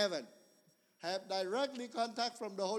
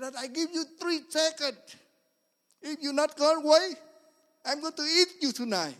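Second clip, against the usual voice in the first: loud, higher threat yelled at a snake; angry shout; 7 semitones higher, 10 dB louder than usual. Retold or imitated. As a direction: bark the ultimatum at the cobra. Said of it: "I was so angry".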